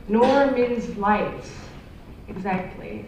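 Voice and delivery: ordinary talk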